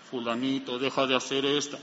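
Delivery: voz grave y lejana, más suave; voz de Dios imitada. Retold or imitated. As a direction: como una voz del cielo que te habla. Imita una voz divina audible. (voz de Dios imitada, grave y suave)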